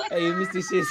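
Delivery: loud, high laughing call; laughing shout. Real, not acted. Calling out while laughing.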